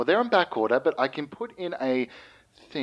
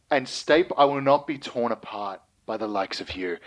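ordinary talk, nothing marked

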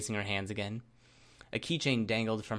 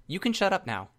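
louder, higher, cut them off; sharp silencing command; 9 semitones higher, 5 dB louder than usual. Acted. Captor silences her taunting hostage, sharp and raised. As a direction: shut the hostage up forcefully.

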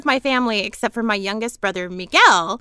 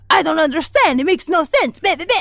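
higher, louder scolding father; nagging dad; 5 semitones higher, 3 dB louder than usual. Retold or imitated. Dad on the phone scolding Monique, loud and high. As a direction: nag her like a worried dad. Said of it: "he's like".

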